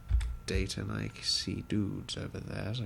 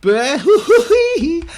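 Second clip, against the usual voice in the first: loud high laugh breaking through; burst of laughter; 18 dB louder than usual. Real, not acted. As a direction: crack up at your own blunder. Ran into enemies, bursts out laughing.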